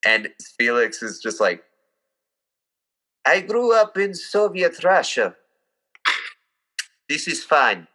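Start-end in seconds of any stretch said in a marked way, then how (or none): 3.3-7.9 put-on higher, deadpan Russian accent; unbothered Russian accent, dry and matter-of-fact. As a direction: shrug it off like an old Russian teacher. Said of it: "an old Russian man".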